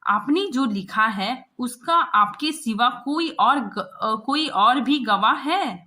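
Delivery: loud, sharp demanding questions; scolding. Acted. Chief correspondent grilling the reporter, loud scolding. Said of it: "मतलब वो उसको डांट रहा है".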